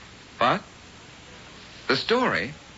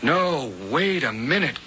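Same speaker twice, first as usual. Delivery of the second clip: usual speaking voice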